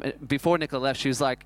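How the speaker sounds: usual speaking voice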